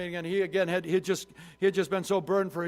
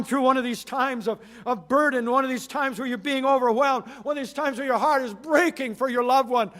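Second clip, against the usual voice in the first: full-force preaching; 3 semitones higher, 8 dB louder than usual. Acted. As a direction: lift it up and proclaim to the congregation.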